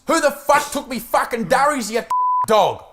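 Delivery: loud, high mock fury; acted angry outburst. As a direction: play a furious little kid demanding answers. Acts out child's angry daycare quote.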